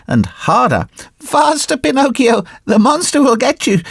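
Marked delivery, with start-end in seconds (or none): 1.2-3.8 high, urgent pleading goat voice. Acted. Little goat on the rock urging Pinocchio on, high and pleading. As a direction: beg him to hurry in a high bleating plea.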